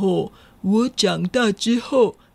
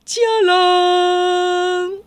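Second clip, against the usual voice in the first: triumphant reveal; 9 semitones higher than usual. Acted. Wolf reveals new look.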